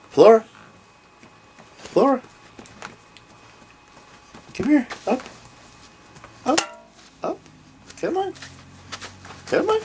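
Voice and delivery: high, sing-song call to a dog; squeaky pet-coaxing call. Real, not acted. Calling the dog over.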